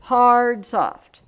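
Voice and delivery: "Hard" loud, "soft" dropped; strong-weak demo; hard then soft. Shown as a demonstration. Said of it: "this one needs to be a soft".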